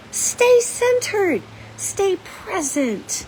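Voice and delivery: very slow, soothing command; slow way down and ground them; steady, drawn-out calming words; calm urging. Real, not acted. Calming her anxious audience.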